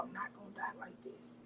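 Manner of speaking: barely-there whisper, fading out. Retold or imitated. Smothered under a pillow, she whispers her plea. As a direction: breathe it out as if losing air.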